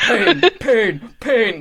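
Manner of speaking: loud crowd chant with a laugh; audience echoing. Acted. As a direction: echo it like a chanting arena crowd. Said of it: "The audience just, like, echoes out after".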